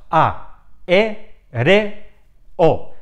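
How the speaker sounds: A, E, RE, O as four separate beats, with pauses between; syllable by syllable. Shown as a demonstration. Teacher splitting aéreo into four syllables for learners, syllable by syllable. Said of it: "cuatro sílabas".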